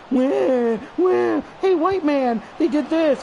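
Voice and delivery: high-pitched whiny wailing imitation; mock whining. Streamer mimicking people who complain about him, high mock whine. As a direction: put on a high crybaby whine.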